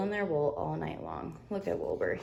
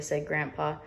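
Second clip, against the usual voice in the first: ordinary delivery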